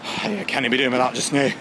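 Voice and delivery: loud broad Scottish grumble; Scottish accent. Pretend. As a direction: shout it like a fed-up Scot. Demonstrates Scottish phrase outdoors.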